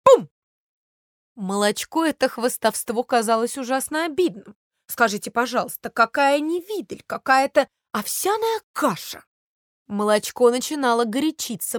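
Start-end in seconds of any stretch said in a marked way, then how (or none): 0.0-0.3 higher, louder bubbly boast; bubbling porridge boast. A narrator's reading. Porridge boasting as its bubble bursts, higher and louder. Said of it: "лопался и говорил". 4.9-9.2 higher, mocking milk; offended scoff. A narrator's reading. Milk scoffing at the boastful porridge, mock offended.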